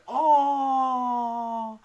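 mock pity. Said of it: "they're like".

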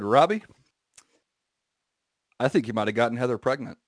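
lightly voiced presidential impression; a hint of the president, with a long pause after the name; Obama. Teasing his friend, light Obama impression. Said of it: "did you say it just like Obama?".

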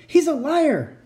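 furious accusing yell; angry shout. A narrator's reading. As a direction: shout it in anger. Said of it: "His face was red with anger".

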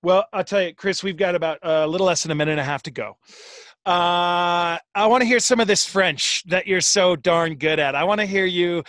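ordinary delivery

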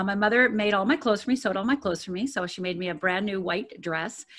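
usual speaking voice

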